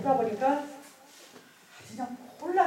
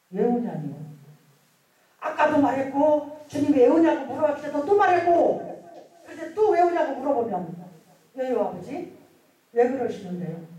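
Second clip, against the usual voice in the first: louder, higher, exasperated complaint; retold angry protest; 4 semitones higher, 7 dB louder than usual. Worshipper answers God's repeated question, retold angry protest. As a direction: re-enact arguing back at God. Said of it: "두 번째 부를 때는 화가 났어요".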